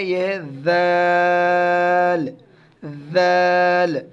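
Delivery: ذال drawn out very slowly; soft letter model. Shown as a demonstration. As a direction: show ذال soft and long. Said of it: "ز اور ذال میں کیا فرق ہے".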